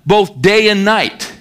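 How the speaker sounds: high, loud pulpit delivery; forceful preaching. Real, not acted. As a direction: drive the point home to the congregation.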